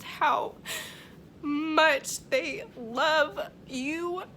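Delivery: fighting back tears on the words; moved, voice breaking; emotional. Real, not acted. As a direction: voice catching, drawn out very slowly. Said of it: "I'm getting extremely emotional".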